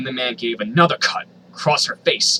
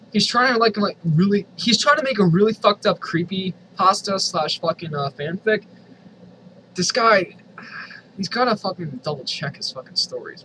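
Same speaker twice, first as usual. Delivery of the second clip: exasperated mockery; 6 semitones higher than usual. Real, not acted. Mocking the fanfic author.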